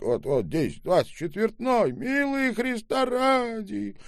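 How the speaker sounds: put-on old man begging the boy, high and pleading. A narrator's reading. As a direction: beg desperately as the old man. Old man offers money.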